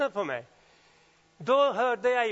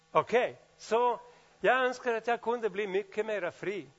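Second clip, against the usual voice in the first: ordinary talk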